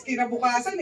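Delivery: usual speaking voice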